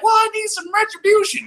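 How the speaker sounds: very high put-on mother's voice; mock mama. Rival's mama demanding retribution, mocking high voice. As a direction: imitate someone's mama in falsetto. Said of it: "your mama's gonna be like".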